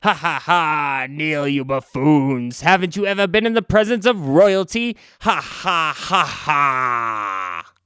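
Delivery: slow, mocking villain laugh and command; gloating villain leader. Alien leader gloating at the band, slow mocking laugh. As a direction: lord it over them, laughing slowly. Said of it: "the entity must be the leader as he says".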